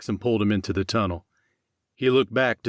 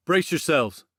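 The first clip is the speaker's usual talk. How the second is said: shouted warning; 7 semitones higher than usual. Acted. Warning before grenade.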